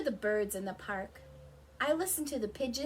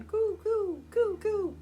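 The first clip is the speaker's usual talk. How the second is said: high, louder pigeon coos; 11 semitones higher, 7 dB louder, slower than usual. Retold or imitated. Imitating the pigeons she hears, high coo. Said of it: "those pigeons going".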